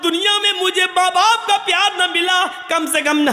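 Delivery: fiery storytelling sermon to the congregation; preaching at full force. Acted. Preacher tells the Judgement story.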